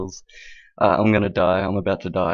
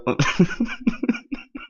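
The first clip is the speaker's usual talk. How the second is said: high laugh thinning into wheezes; real helpless laughter; 10 semitones higher than usual. Real, not acted. Host failing to finish the sign-off, helpless laughter. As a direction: laugh until the breath is gone. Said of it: "I'm out of breath from laughing".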